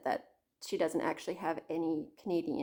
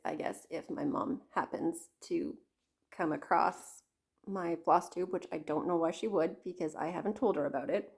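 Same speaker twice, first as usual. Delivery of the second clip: ordinary talk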